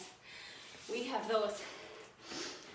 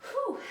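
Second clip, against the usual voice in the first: high, short whew of exertion; sharp exhaled whew; 13 semitones higher, 4 dB louder than usual. Real, not acted. Trainer finishing a set of shoulder taps, sharp whew. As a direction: blow out a real breath of relief.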